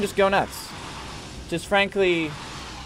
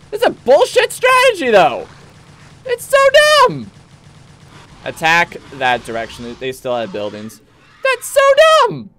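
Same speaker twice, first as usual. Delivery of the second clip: high, loud fed-up squeal; exasperated outburst; 9 semitones higher, 6 dB louder than usual. Real, not acted. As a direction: vent in high disbelief.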